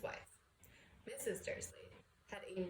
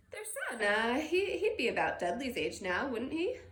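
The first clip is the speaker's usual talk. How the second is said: much higher, louder mock-casual; forced casual; 3 semitones higher, 7 dB louder than usual. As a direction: pretend it's a passing thought. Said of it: "as casually as he could".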